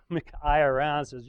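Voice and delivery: ordinary talk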